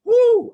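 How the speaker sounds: loud, high sarcastic cheer; a mocking "woo" at the listener's excitement; mock excited whoop. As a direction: whoop it up like a fake fan. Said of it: "you are excited about it".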